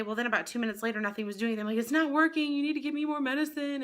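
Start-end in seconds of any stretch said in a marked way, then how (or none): 1.8-3.8 retold panicky plea, pitched high; higher, pressing demand for help; urgent complaint. Patient tells anesthesiologist the sedative has failed, urgent and high.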